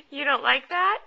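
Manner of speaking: normal talking voice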